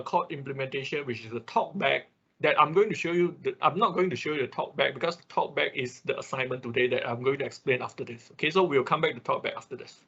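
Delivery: usual speaking voice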